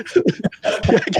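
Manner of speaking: high, airless burst of laughter; breathless laugh. Real, not acted. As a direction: laugh without voice, helplessly. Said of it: "I can't say it with a straight face".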